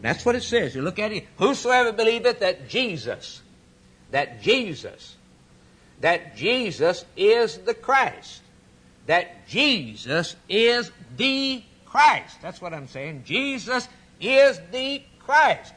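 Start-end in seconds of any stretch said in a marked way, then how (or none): 1.4-12.4 "that Jesus is the Christ" ever slower, each word pitched high; emphatic building repetition. Real, not acted. Preacher reading the text to the congregation, building in slow emphasis. 13.2-15.7 "Jesus is the Christ" slow and high, every word weighted; ringing proclamation. Real, not acted. Preacher declaring who Jesus is, slow and emphatic.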